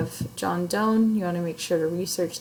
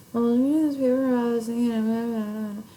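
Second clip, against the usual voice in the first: higher, slurred mumble with words running together; demonstrating bad enunciation; mumbled, unclear speech; 3 semitones higher than usual. Shown as a demonstration. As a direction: mumble it as one long mushy drone. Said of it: "That's not announcing everything pristinely".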